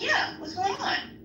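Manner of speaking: high, slow questioning quote; retold puzzled reply. Worker interrupted by her sergeant, high puzzled reply.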